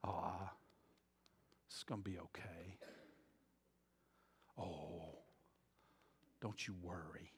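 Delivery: a condescending soothing whisper; imitating a friend who doesn't have a clue, hushed and patronizing; patronizing comforter. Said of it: "they pat your hand and say".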